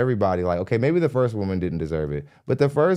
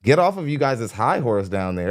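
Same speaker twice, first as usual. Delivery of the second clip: ordinary talk, nothing marked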